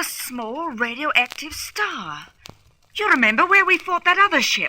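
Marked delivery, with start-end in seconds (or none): 2.9-4.7 excited. Acted. Excited realization.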